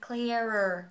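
"Clearer" joined as clea-rer; linked r sound. Shown as a demonstration. Teacher repeating the link for learners, "Clearer" run together. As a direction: carry the r over in "Clearer". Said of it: "nối sang".

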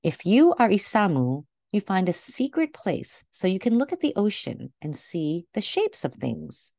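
ordinary delivery

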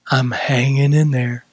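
low, weary mock reply; acting faint. Preacher mimics a dry believer answering a greeting, faint. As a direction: drop the pitch and barely hang on. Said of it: "I will act faint".

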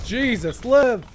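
frantic shout. Real, not acted. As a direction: yell the name in panic. Friend down in battle.

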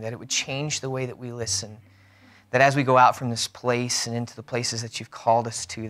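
low, soft, measured prayer. Real, not acted. Pastor prays with the congregation before the sermon, low and soft. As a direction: lead them in quiet, reverent prayer.

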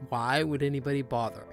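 usual speaking voice

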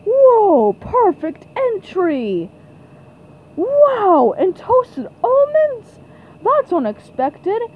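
high, wowed sportscast; amazed commentator. Acted. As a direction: marvel aloud at a great dive.

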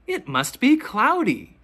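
ordinary delivery

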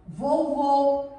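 vovô with a closed final ô; som fechado. Shown as a demonstration. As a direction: round and close the last vowel of vovô. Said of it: "pronunciado com um som mais fechado". (vovô com o ô final bem fechado)

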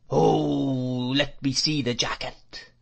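put-on old man's wail, opening on a long swelling word; crying out whiningly. A narrator's reading. Old shopkeeper answering the boy, whining cry. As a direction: drone it out as an eccentric cry. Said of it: "cried the man".

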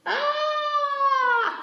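high, drawn-out storybook "ah"; scream. Acted. Reader voicing the animals as they tumble, mock scream. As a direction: cry out on a long high note.

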